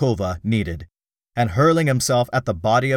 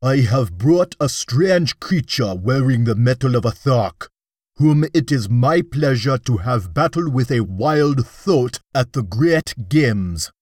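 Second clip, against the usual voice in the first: a warrior chieftain's menacing boast, voiced lightly; loud and menacing; 3 dB louder than usual. A narrator's reading. Dak Kova to the young Jeddak, a menacing, defiant boast. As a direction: push the captive forward and threaten with relish. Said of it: "he exclaimed in a loud and menacing voice".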